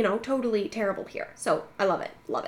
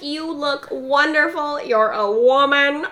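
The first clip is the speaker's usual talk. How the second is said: slow, grand, higher proclamation; hammed-up gushing; 4 semitones higher, 6 dB louder, slower than usual. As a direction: make a mock scene of admiring her. Friend making a scene, acted out.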